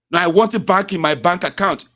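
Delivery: angry customer. Retold or imitated.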